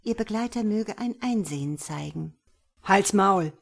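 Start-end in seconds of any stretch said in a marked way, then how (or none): 2.8-3.5 loud, curt snarl at a passenger; snapped order to shut up; angry bark. A narrator's reading. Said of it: "blaffte er".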